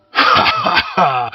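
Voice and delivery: slow, hearty ha ha ha; laughing. Acted. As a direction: laugh it out broadly. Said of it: "He broke into laughter again".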